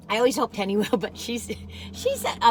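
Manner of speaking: ordinary talk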